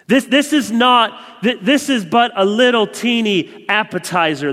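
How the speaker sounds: loud, rising, fervent preaching; preaching at full force. Acted. Preacher telling the church of the coming feast, fervently. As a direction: lift the room with rising hope. Preaching about the Lord's table.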